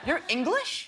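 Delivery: high-pitched mock disbelief; surprised fan. A fan hears Spider-Man speak, surprised. Fans react to hearing his accent.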